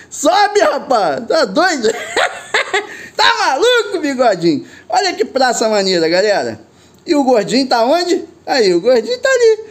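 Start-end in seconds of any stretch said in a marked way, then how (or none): 0.1-1.9 high, startled cry up at the drone; high excited yell; alarmed call. Real, not acted. Drone nearly hits the wire. 1.9-4.7 high, laughing tease; high giggling disbelief. Real, not acted. Laughing after the near miss. 8.5-9.6 laughing. Real, not acted. Spots friend, laughing.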